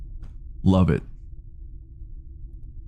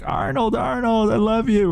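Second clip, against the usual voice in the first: put-on excited kid; 14 semitones higher than usual. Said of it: "And I'm like".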